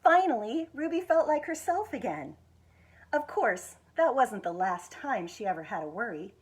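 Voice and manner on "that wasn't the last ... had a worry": ordinary delivery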